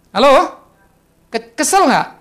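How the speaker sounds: ordinary delivery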